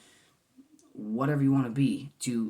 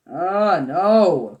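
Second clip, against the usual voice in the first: a sudden loud, higher yelp of embarrassment; embarrassed loud oh no; 6 semitones higher, 13 dB louder than usual. Real, not acted. Embarrassed at getting cheesy on camera, loud cringing yelp. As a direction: cry out, cringing at yourself.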